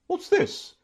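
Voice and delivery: very high-pitched, startled aunt; dismayed cry. A narrator's reading. Aunt startled by news of war, dismayed. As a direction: demand it in a high, alarmed voice. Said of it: "cried my aunt in dismay".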